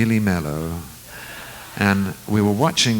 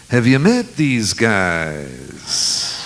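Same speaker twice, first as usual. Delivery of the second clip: [name] imitation; 3 dB louder, much slower than usual. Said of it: "flipped on the lights and said".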